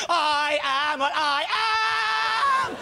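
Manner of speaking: slow, drawn-out declaration of pride; man in a pineapple suit booming out who he is; proud proclamation. Retold or imitated. Pineapple-suit guy announcing himself proudly, slow and stretched.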